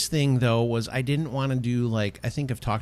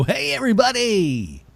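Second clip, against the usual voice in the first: show-opening greeting; 11 semitones higher, 6 dB louder than usual. Shown as a demonstration. Host mocking his own show intro, overblown greeting. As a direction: belt it out like an overeager host. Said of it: "I didn't wanna go".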